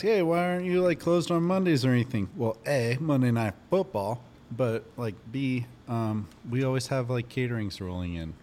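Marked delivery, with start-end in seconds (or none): 0.0-2.3 raised, louder voice of a curious customer. Retold or imitated. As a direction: pop up high and loud, asking a customer's question. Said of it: "Some people ask".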